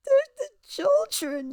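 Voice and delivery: high, loud mock lament; hammed-up cry of distress; mock wailing. Mocking the singer's plea for children, exaggerated wail. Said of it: "film herself fake crying".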